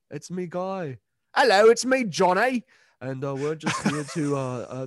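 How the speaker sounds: lightly voiced band ad pitch; hammed-up mock sponsor read. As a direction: pretend to be the singer plugging the album. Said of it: "Hi, my name's [name]".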